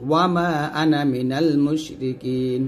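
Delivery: slow recited ana kept short; Quranic recitation. Shown as a demonstration. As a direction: recite slowly, no stretch on ana. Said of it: "এই যে আনাতে টান দেওয়া যাবে না".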